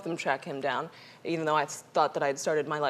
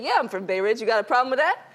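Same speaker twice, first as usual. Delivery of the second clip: put-on tough Brooklyn girl; 6 semitones higher, 6 dB louder than usual. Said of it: "I talked like I was Tina Shamiro".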